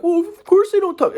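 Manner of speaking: put-on squeaky girlfriend voice; Katie. Girlfriend reasoning about his family, in mocking falsetto. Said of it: "in Katie's mind, she's like".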